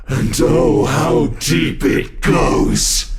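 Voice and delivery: a burst of gleeful rapture, drawn out and loud; ecstatic outburst. Acted. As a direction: roar out the delight in the depths of terror. Monster exults over terror.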